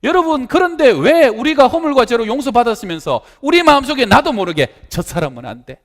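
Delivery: high, loud, impassioned sermon voice; fervent preaching. Acted. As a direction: preach it hard to the congregation. Preaching at full force.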